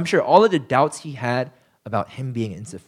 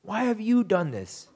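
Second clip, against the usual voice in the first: accusing Moses voice; 4 semitones higher than usual. Retold or imitated. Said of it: "He says".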